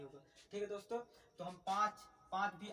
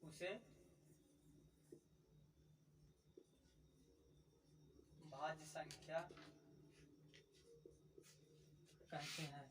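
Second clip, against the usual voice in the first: dictating word by word with long pauses; slow dictation; 3 semitones lower, 12 dB quieter, slower than usual. Acted. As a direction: spell it out slowly so students can write. Dictates definition slowly with pauses. (धीरे-धीरे बोलकर लिखवाना)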